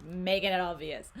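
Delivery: ordinary talk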